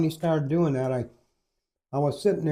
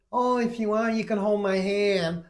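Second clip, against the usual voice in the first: high, cooing camp offer; mock camp nurse; 6 semitones higher than usual. Nurse offering comfort to screaming patient, mock camp voice.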